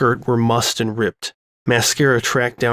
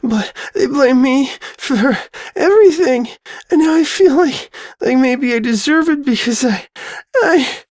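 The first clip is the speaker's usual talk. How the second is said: hyperventilating sobs; 13 semitones higher than usual. Acted. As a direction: sob and gasp through every word. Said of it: "She started to hyperventilate".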